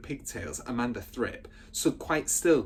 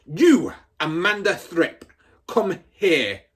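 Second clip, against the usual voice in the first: higher, louder barked command; angry shout; 6 semitones higher, 9 dB louder, slower than usual. Acted. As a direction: summon her like a drill sergeant. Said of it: "she was shouting".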